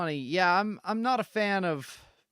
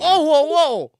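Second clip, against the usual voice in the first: startled shout; 9 semitones higher, 12 dB louder than usual. Real, not acted. Startled by creature's advance.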